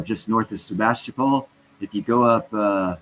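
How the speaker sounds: usual speaking voice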